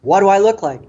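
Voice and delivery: urgent yelled plea; yelling. Retold or imitated. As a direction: yell it, desperate for an answer. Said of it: "meaning, like".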